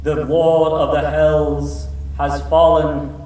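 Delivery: very slow, solemn proclamation; booming voice from the sky; distant female voice. Acted. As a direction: declare it like a heavenly herald. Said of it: "And the voice continues".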